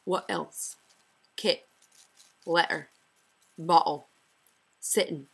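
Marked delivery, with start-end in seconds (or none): 1.4-5.3 letter and bottle with a glottal stop for T; Scottish glottal T. Shown as a demonstration. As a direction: swallow the T the Scottish way. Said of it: "For example".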